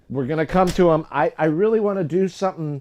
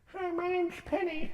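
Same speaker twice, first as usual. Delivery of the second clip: put-on much higher and softer, squeaky girlish; high squeaky Penny voice; 8 dB quieter than usual. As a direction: pipe up in a tiny high voice. Voices a character called Penny.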